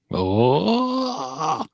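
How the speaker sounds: long, high-pitched rising "oh"; mock wail. Storyteller acting out the vampire fight, high drawn-out wail.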